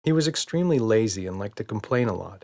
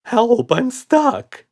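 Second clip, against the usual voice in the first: much higher, loud, very slow cry for help; panicked call; 14 semitones higher, 8 dB louder, much slower than usual. A narrator's reading. Red stuck in the hamper, crying out in panic. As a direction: wail for help while stuck. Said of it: "called Red".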